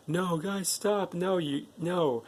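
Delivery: high-pitched pleading to stop; imitated protest. Boy protesting to his friends.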